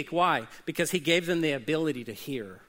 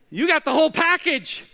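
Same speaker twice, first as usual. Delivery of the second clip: higher and louder, driving the point home; rising preacher shout; 9 semitones higher, 7 dB louder than usual. Real, not acted. Pastor to congregation, loud and lifted. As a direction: punch it out to the room.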